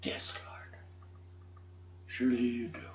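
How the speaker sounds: breathy whispered reply to the Lord; hushed reverent whisper. Retold or imitated. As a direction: whisper it in awe. Answering Jesus in awe.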